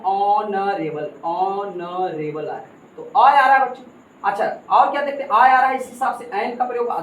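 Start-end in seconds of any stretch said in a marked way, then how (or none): 0.0-2.7 ऑनरेबल stretched very slowly, opening ऑ held; drawn-out ऑनरेबल. Shown as a demonstration. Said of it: "ऑनरेबल में ओ आ रहा है". (ऑनरेबल को धीरे खींचकर बोलना)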